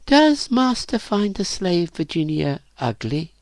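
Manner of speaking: put-on high, pleading girl's question; timid question. A narrator's reading. Virginia asks master whether she is ugly, timid. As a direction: ask it high and meekly.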